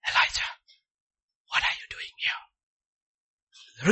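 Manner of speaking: slow, breathy hushed whisper; tender whispered question. Acted. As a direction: whisper it gently like a father.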